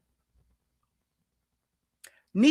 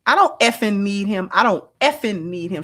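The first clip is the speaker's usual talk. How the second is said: effing leaned on hard, louder; stress on effing; 4 dB louder than usual. Retold or imitated. Host mimicking guest's stress on effing. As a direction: punch effing in I don't effing need him. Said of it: "She put emphasis on that".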